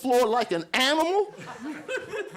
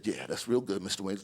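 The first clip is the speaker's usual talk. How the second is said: much lower, softer doctor voice; soothing doctor; 12 semitones lower, 6 dB quieter than usual. Retold or imitated. Doctor reassuring a nervous patient in a low voice.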